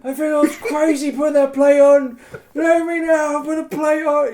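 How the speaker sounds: high, loud giddy babble; giddy relief. Retold or imitated. Player relieved about wearing the plate, giddy loud. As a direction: gush with relief. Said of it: "And I'm going".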